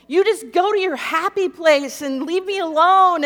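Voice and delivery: desperate rant at a husband, line after line; sheer desperation. Retold or imitated. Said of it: "just out of sheer desperation".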